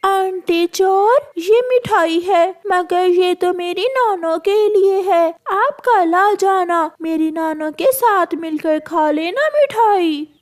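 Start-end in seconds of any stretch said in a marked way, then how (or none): none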